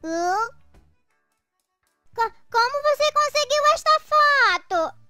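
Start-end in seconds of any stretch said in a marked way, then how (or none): 2.1-5.0 shocked stammer. Acted. Caught by a compromising photo.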